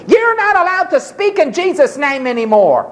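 lightly voiced stern officials; stern authorities. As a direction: rulers forbidding the apostles. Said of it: "the authorities came to Peter and says".

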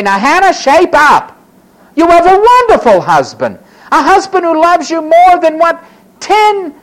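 loud, high-pitched pep-talk scolding; bracing rebuke. Acted. Preacher urging Hannah to shape up, bracing rebuke. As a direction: tell her firmly to pull herself together.

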